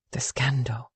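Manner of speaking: breathy whisper to herself; whispered echo. A narrator's reading. Willa echoing her friend's warning in a whisper. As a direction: let the word fall in a hush.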